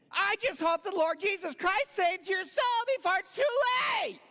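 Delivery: high, loud mock shout of condemnation; self-righteous preacher. Seatmate condemning him on plane.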